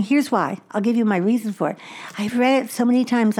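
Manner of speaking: ordinary delivery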